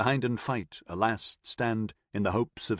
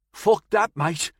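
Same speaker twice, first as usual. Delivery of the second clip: lightly voiced breathless Midlands shout; a hint of a thick Midlands marine; Midlands accent; 10 semitones higher, 5 dB louder, slower than usual. A narrator's reading. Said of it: "breathless and unexpectedly thick Midlands accent".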